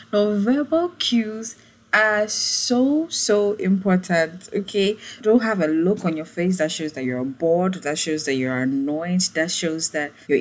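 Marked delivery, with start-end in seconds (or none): none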